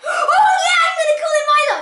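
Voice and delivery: high, loud burst of delight; excited squeal. Real, not acted. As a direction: shout it high, thrilled by the idea. Delighted at finding the dog's name.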